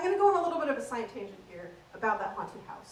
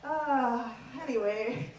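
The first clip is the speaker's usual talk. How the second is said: ordinary talk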